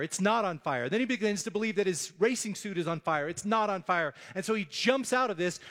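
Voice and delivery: usual speaking voice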